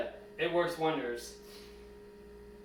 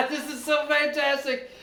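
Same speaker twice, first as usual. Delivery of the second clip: high, loud laughing delight; laughing with joy; 9 semitones higher, 4 dB louder than usual. Real, not acted. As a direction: laugh it out in pure relief. Joy at metal coming out.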